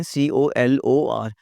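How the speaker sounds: slow, letter by letter spelling. Shown as a demonstration. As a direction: spell each letter slowly and clearly. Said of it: "spelling देखें". (धीरे-धीरे अक्षर बोलना)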